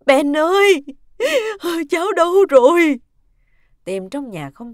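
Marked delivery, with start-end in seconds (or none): 0.1-3.0 put-on loud, high, sobbing call for a lost child; anguished wail at full voice; wailing cry. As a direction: cry out desperately while searching. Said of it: "bà vừa gào khóc".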